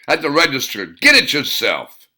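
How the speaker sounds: dismissive and hard; curt reply. Acted. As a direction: tough guy brushing him off. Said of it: "Duke snapped".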